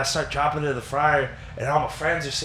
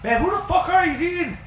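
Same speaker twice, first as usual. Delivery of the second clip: much higher, mock outraged question; cussing cook; 13 semitones higher than usual. Cook realising a stranger is in the kitchen, high mock outrage.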